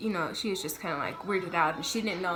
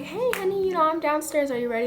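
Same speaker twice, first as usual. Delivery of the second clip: higher, bright-sounding greeting as mom; mother calling up cheerfully, pitched higher; mom's sweet phone voice; 9 semitones higher, 4 dB louder than usual. Retold or imitated. Mom phones her daughter from the car, imitated sweetly and higher.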